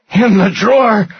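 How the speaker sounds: high, loud strained answer; pained cry. A narrator's reading. Rudder asked for the truck's title deed, crying out. Tortured man answering in pain.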